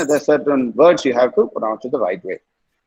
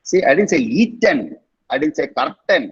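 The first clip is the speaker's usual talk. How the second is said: ordinary talk, nothing marked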